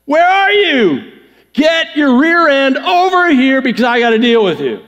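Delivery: angry God hollering high for Adam; high, mock-furious shouting; all-caps yell. Shown as a demonstration. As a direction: bellow like a fed-up parent, words stretched. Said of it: "We can do it all caps".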